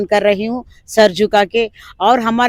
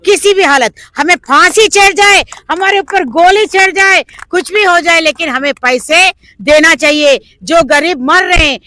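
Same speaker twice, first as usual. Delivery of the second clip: raised high, louder protest cry; impassioned shout; 5 semitones higher, 4 dB louder than usual. Real, not acted. Protest leader demanding investors' money, a loud impassioned cry. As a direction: rouse the crowd, voice up high and loud.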